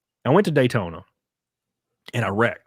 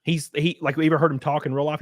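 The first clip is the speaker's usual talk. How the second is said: usual speaking voice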